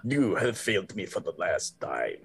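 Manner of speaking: lightly Scottish Vader, low and quiet; a hint of a Scottish Sith lord; Scottish Darth Vader. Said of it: "Darth Vader with a Scottish accent".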